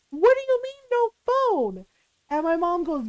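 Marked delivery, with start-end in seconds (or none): 0.1-1.9 high, pitched-up shocked whine; outraged disbelief. Retold or imitated. Sister shocked at losing her phone, high outraged whine. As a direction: squeal the question in disbelief.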